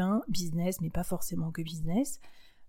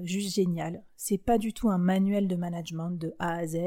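ordinary talk

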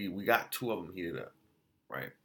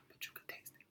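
whisper; 19 dB quieter, much faster than usual. Real, not acted. Secret about the taste.

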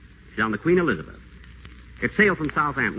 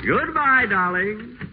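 mock sweet farewell; 11 semitones higher, much slower than usual. Whiteside mock-sweet after Maggie leaves.

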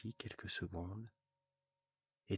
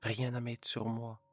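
louder, plaintive wife; wife lamenting to her husband, louder; complaining wife; 2 semitones higher, 6 dB louder than usual. Acted.

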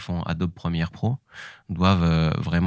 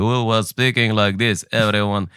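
slow, higher, put-on Qatari accent in English; comedian's slow, higher caricature of a Qatari speaking English; mock Qatari accent; 3 semitones higher, slower than usual. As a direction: sound like a Qatari character taking all the time in the world. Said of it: "j'incarnais un, un Qatari".

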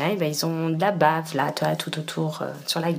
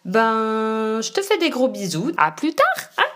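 excited; 6 semitones higher than usual. Real, not acted. Cheerful goodbye kisses.

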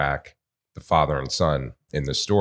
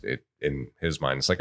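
ordinary delivery

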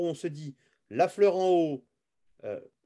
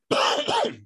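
sharp real coughing fit; 3 dB louder than usual. Real, not acted. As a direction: cough hard, caught off guard. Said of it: "je tousse un peu".

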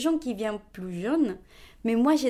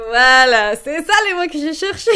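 bursting into laughter, higher and louder; laughing; 6 semitones higher, 11 dB louder than usual. Real, not acted. Relieved to the interviewer at finding the word, laughing. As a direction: laugh through the words.

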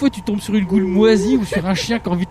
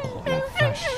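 surprised; 5 dB quieter, much slower than usual. Real, not acted. Reaction to the endless fall.

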